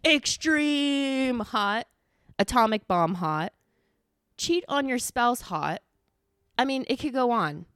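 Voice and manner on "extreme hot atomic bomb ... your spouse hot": hugely drawn-out, raised announcement; hyped-up exaggeration. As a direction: stretch EXTREME out long for comic escalation. Escalating list of absurd spice levels.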